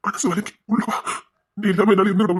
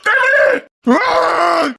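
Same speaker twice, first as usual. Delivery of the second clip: very high, loud panicked call; panicked cry; 11 semitones higher, 5 dB louder, much slower than usual. Acted. Father crying to Vicky to lift the boy, high panicked shout.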